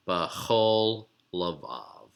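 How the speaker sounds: "bachol lavav" said slowly, syllable by syllable; slow demo phrase. Shown as a demonstration.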